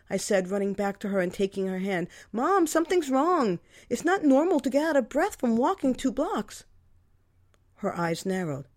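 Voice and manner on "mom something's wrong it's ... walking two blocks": anxious daughter pleading; worried plea. A narrator's reading. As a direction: urge her mother with alarm.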